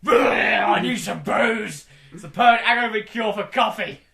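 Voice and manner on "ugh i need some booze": hungover biscuit. Retold or imitated.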